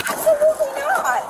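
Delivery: sharp, high refusal; flatly refuse, voice jumping up; defiant refusal. Real, not acted. Refusing officer's order.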